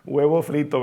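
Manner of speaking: laughing fondly through the words; father laughing. Retold or imitated. Father laughs at his boy's offer, amused chuckling. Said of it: "el papá se ríe".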